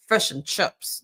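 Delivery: imitated New Zealand vowels, mouth barely open; New Zealand accent. Showing how New Zealanders talk, New Zealand accent. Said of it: "New Zealand accents".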